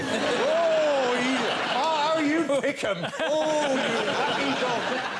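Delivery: high, laughing, gushing flattery; gushing fawning praise. Retold or imitated. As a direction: lay on the admiration thick, high and giggly. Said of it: "they'd all have to go".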